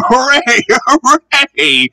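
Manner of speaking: high joyful hooray with a laugh; cheering. Acted. Bertie cheering as he spots the train. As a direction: cheer as he tops the hill. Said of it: "he cheered".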